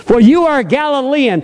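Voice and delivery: persistent accuser. Acted. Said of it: "She was persistent".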